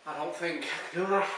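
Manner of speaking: struggling for breath, pitch rising at the end; overwhelmed and panting; real distress. Real, not acted. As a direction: strain it out between heavy breaths. Struggling to breathe through burning challenge.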